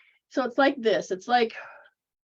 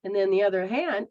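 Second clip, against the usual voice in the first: usual speaking voice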